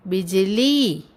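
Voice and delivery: बिजली with the final ली held long; drawn-out teaching demo of बिजली. Shown as a demonstration. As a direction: stretch the last vowel of बिजली. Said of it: "शब्द का उच्चारण थोड़ा लंबा होता है".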